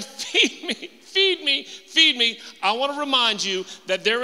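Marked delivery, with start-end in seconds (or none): 0.0-2.4 high, mocking "feed me" whine with a laugh; a spoiled child begging to be fed; whiny churchgoer. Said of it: "you're going".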